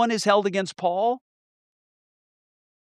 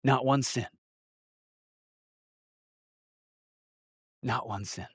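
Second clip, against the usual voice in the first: hushed fading repeat; 6 semitones lower, 10 dB quieter than usual. Real, not acted. Quiet repeated close of sermon.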